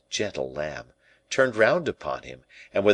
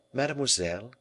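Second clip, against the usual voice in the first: higher, lightly questioning address; gentle; 6 semitones higher, 4 dB quieter, faster than usual. A narrator's reading. King softens toward La Valliere, speaking gently.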